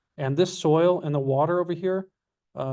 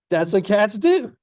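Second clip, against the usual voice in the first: loud, higher exasperated insistence; emphatic repeat; 7 semitones higher, 7 dB louder than usual. Retold or imitated. Telling the panicking caller about his cat, loud insistence. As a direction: punch it out louder, pitch climbing to the end.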